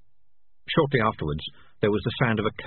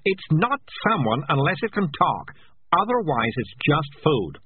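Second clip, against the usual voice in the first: higher, slow, emphatic correction; insistent; 8 semitones higher, 3 dB louder, slower than usual. Acted. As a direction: spell it out slowly and firmly. Cat insists food is not someone.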